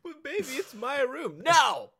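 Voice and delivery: high, loud whining plea; mock whiny plea. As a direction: whine like a dumped boyfriend. Mock whiny pleading partner.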